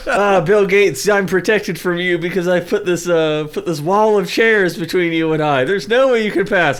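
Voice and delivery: mock defiance. Taunting Bill Gates from behind a wall of chairs, mock defiance. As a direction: play a cocky hero behind defenses.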